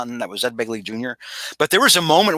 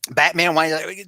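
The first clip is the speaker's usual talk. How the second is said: ordinary talk, nothing marked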